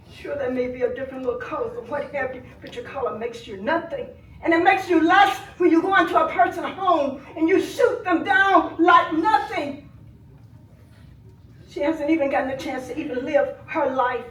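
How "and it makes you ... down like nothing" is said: louder and higher, the last words slowed; furious shout. Real, not acted. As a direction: hurl the accusation at them. Grieving aunt denouncing police killing.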